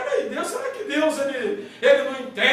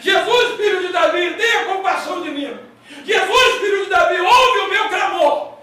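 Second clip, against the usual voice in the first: crying out to Jesus; 3 semitones higher than usual. Retold or imitated. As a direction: call out to Jesus begging for mercy. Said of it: "ele começou também a gritar".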